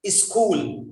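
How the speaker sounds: "School" with an extra i in front, is-kool; "School" said as iskool; mispronounced school. Shown as a demonstration. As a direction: put a short "i" before the s of "School". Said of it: "There are some people who say".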